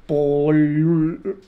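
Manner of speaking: "pol-" with the L held long; accented L. Shown as a demonstration. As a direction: hang on the L of "pol-" as if it carried an accent. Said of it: "cómo se pronuncia más fuerte". ("pol-" con la ele alargada)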